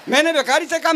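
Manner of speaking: ordinary talk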